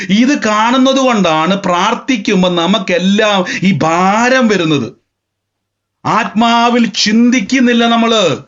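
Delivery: high, insistent sermon delivery; impassioned preaching. Real, not acted. Preacher urging Hannah to picture Samuel as she prays, impassioned.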